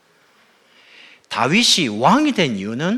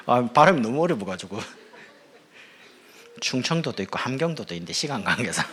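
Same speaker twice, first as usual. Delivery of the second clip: ordinary talk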